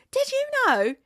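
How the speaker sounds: very high imitated question; excited reveal. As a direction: whip round with a squeaky-high bit of trivia. Keely whips round, acted quote.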